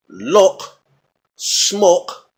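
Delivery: "lock, smoke" with the same rounded British vowel; rounded o, near "look", in both words; London pronunciation. Shown as a demonstration. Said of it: "you may say it different".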